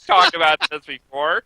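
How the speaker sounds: high, laughing through the words; can't keep a straight face. Real, not acted. Laughing at obvious Dallara win.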